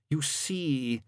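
leaden, world-weary explanation; gloomy character voice; fatalistic gloom. A narrator's reading. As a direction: say it with heavy resignation. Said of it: "his voice leaden with fatalistic gloom".

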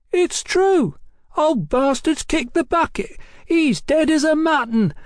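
put-on very high, shrill boy's voice; giddy, high-pitched taunting cry; boy yelling. A narrator's reading. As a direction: yell it down gleefully. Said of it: "the boy yelled".

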